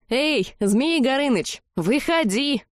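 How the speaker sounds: high, slow calling out; ringing call. A narrator's reading. Girl calls the dragon out of the portal, ringing call. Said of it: "Звонко позвала я".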